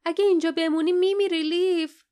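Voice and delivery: high, loud cry of alarm; warning shout. A narrator's reading. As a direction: shout a desperate warning at a friend. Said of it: "فریاد زد".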